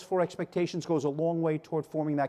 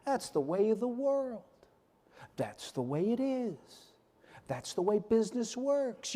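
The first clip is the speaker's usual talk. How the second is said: a hint of an eye-rolling skeptic; drawn-out shrugging dismissal; mock dismissive audience; 3 semitones higher, 4 dB quieter, slower than usual.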